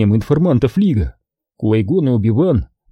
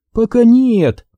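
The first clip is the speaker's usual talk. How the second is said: smirking; 11 semitones higher, 4 dB louder, slower than usual. A narrator's reading. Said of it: "усмехнулась Астри".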